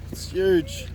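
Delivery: ordinary talk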